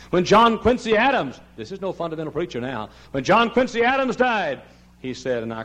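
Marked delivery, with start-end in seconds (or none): 0.1-1.4 high, loud pulpit announcement; raised oratorical proclamation; emphatic preaching. Real, not acted. Preaches at full force. 3.1-4.6 high, ringing pulpit announcement; raised oratorical cry; emphatic preaching. Real, not acted. Preaches at full force.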